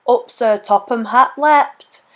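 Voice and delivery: usual speaking voice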